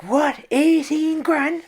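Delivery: high, loud, drawn-out incredulous echo; stretched squeal of disbelief; shocked disbelief. Retold or imitated. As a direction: blurt it back in hammed-up astonishment. Acting his shock at the loss.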